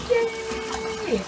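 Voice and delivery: high delighted laugh then yay; excited giggle and cheer. Real, not acted. Vlogger cheers as the bath bomb fizzes. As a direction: squeal with glee. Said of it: "I'm so excited".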